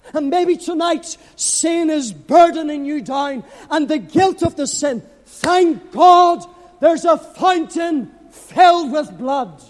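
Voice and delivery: ordinary delivery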